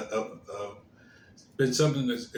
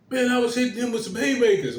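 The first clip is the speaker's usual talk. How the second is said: high, fast boastful brag; bragging cop; 11 semitones higher, 5 dB louder, much faster than usual. Retold or imitated. Retelling a cop boasting about the beating, high fast brag. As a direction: toss it off like a cocky brag. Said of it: "The guy bragging said".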